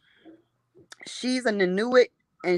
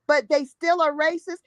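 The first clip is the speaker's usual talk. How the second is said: ordinary delivery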